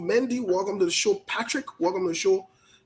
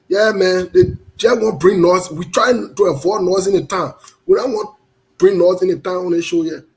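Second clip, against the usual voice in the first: loud rant; 8 dB louder than usual. Real, not acted. Loud rant against noise.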